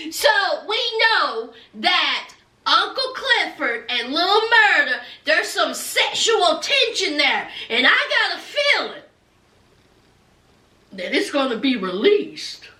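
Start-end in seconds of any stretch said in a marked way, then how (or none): none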